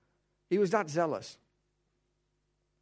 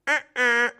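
high, loud "Uh-uh" of flat denial; Paul's firm refusal; 8 semitones higher, 8 dB louder than usual. Retold or imitated. Paul rejecting the listener's excuse, high and loud "Uh-uh". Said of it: "Paul would say".

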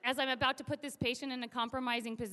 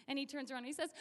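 ordinary talk, nothing marked

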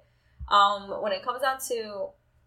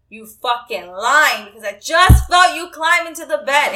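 retold yelled accusation; 5 semitones higher, 11 dB louder, slower than usual. Accusing boyfriend of lying.

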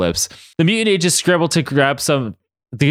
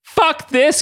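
exasperated. Acted. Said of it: "Katie said".

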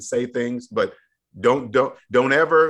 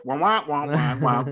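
muffled wah wah talk; droning wah wah like a muted trombone; Charlie Brown teacher voice. Shown as a demonstration. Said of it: "that Charlie Brown teacher voice".

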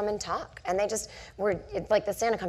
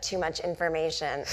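normal talking voice

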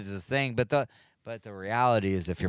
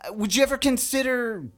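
excited fan voice; 11 semitones higher, 6 dB louder than usual. Acted. Brendan character.

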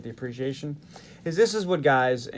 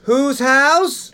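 louder call-out; 12 semitones higher, 12 dB louder than usual. Shown as a demonstration. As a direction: shout "house" much higher and louder, rising at the end. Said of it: "Let's try it again".

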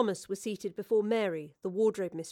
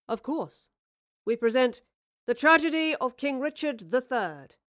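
grand announcement; 4 semitones higher, 4 dB louder than usual. A narrator's reading. Announces play title.

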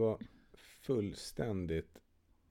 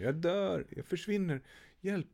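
panic in the voice; 10 semitones higher than usual. Retold or imitated. Husband imitating his wife's panic attack, high panicked cry. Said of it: "det var verkligen så här panik i rösten".

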